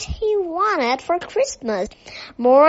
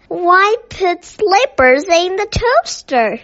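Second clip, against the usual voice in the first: higher, louder storybook scolding; indignant cry; 5 semitones higher, 5 dB louder than usual. A narrator's reading. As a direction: demand an answer with a raised voice. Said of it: "cried Missus Christmas".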